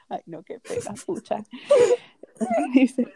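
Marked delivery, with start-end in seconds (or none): 1.5-2.0 high, loud laugh breaking into a cry; helpless laugh at the memory. Real, not acted. Embarrassed laughing cry telling listeners about the sandal.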